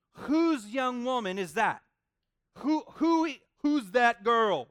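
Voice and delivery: put-on loud, slow, awestruck who's that girl; smitten Boaz. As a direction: belt it out like a man struck by her beauty. Preacher acts out smitten Boaz.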